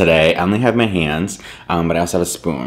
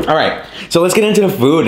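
louder, higher, fast and eager; excited; 11 semitones higher, 6 dB louder than usual. Real, not acted. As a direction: jump in with energy. Launching into the food.

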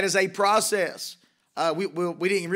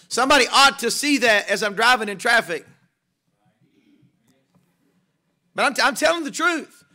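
rising preaching fervor; 4 semitones higher, 3 dB louder than usual. Acted. Preaching climax, building intensity.

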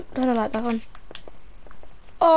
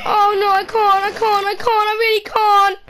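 much higher, crying and pleading; tearful panic; 10 semitones higher, 4 dB louder than usual. Real, not acted. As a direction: whimper it out on the verge of tears. About to cry, crying heard.

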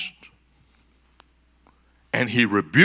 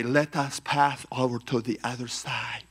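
softer, formal mock-biblical reading; stiff scripture recital; 6 dB quieter than usual. Preacher mocking how Bible English makes Jesus talk, stilted.

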